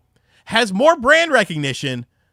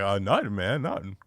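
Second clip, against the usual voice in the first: sheepish denial; 9 semitones lower, 8 dB quieter than usual. Retold or imitated. Kid denying guilt to dad.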